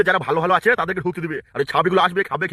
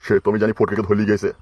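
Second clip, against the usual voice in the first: put-on much lower, gruff old man's voice; old father-in-law; 7 semitones lower than usual. Old father-in-law tells his daughter-in-law he won't help his son, in a low old voice.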